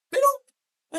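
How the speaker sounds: high-pitched protesting exclamation; emphatic objection. Real, not acted. Preacher correcting believers who say heaven will help, emphatic objection. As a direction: object sharply, voice leaping right up. Rejecting a wrong saying.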